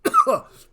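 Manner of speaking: sudden high spluttering cough. Acted. GM acts prisoner waking.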